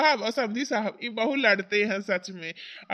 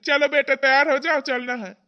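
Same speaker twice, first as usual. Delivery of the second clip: calling out; 4 semitones higher, 6 dB louder than usual. Acted. Father calling daughter to leave.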